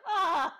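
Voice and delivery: sudden loud high yelp; startled cry. Retold or imitated. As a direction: yelp like you've been zapped. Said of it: "he's just like".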